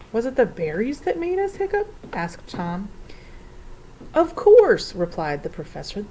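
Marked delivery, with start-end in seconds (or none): 0.1-1.9 put-on high, questioning child voice; child's question. A narrator's reading. Tom asking the professor about berries, child's voice. 4.1-4.9 high, loud exclamation; sudden realization. A narrator's reading. Professor answering Tom, loud exclaimed realization.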